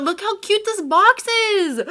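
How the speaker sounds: thrilled unboxing gush; excited. Real, not acted. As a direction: squeal it out, delighted. Excited over the box.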